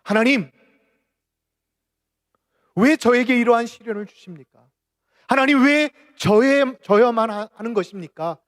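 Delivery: loud, high anguished plea, imitated; crying out to God. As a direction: act out a bereaved father's shouted protest to heaven. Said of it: "외쳤다고 합니다".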